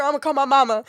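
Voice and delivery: high, loud, giggly protest; cracking-up mock threat; hysterical laughing plea. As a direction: protest loudly through laughter.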